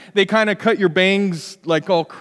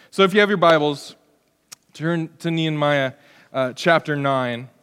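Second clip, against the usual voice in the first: normal talking voice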